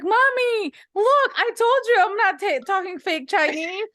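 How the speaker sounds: put-on high, loud kid's voice insisting; child protesting to his mother, high and loud; son's protest. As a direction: play the indignant little boy, half laughing. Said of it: "And I'm like".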